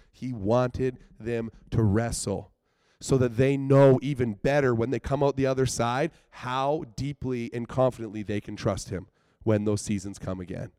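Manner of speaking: usual speaking voice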